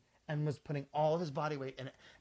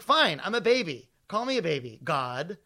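exasperated retort; 6 dB louder than usual. Retold or imitated. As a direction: throw it back in hammed-up exasperation. Acted retort to scolding masseur.

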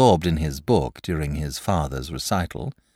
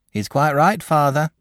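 lightly voiced country mother, higher; sensible mother; 9 semitones higher, 4 dB louder than usual. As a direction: back the boy as a sensible mother. Mother backs the boy.

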